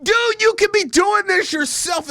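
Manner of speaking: high-pitched exasperated outburst; frustrated, high-voiced scolding; exasperated retort. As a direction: re-enact pleading with a streamer, pitch way up. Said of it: "And, and I'm like".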